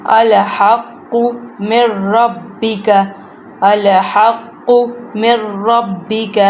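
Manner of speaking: slow, measured tajweed recitation; Quran recitation. Shown as a demonstration. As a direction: recite word by word, drawing out the long vowels. Teacher recites verse word by word. (ٹھہر ٹھہر کر تجوید سے تلاوت)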